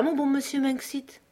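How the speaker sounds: high, pleading flattery; wheedling Fata. A narrator's reading. Fata wheedling Manxit to dodge blame, pleading. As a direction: coax him with sugary deference.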